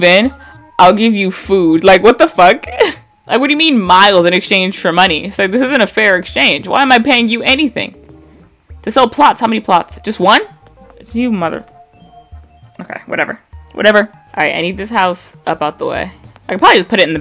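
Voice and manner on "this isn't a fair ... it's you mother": normal talking voice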